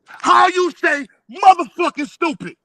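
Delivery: high, loud, slow scream. Real, not acted. Streamer screaming about the Atlantis book, loud. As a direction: scream it out in disbelief. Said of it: "let me scream out".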